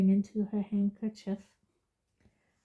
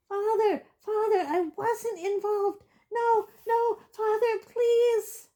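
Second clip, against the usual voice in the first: high, pleading cry; desperate plea; 13 semitones higher than usual. Acted. Boy in chains begging his father, high desperate plea. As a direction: beg your father, voice cracking high.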